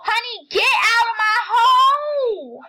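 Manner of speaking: slow, loud laughing shout; laughing yell. Real, not acted. Yelling at sister, laughing.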